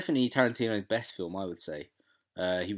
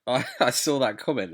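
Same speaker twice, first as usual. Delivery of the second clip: usual speaking voice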